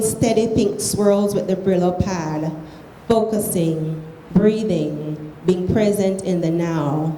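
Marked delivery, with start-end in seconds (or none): none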